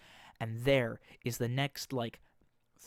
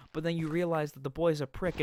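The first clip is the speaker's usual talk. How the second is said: ordinary talk, nothing marked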